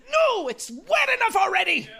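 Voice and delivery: loud high scold; shouted scolding. Retold or imitated. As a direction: yell it like scolding a dog.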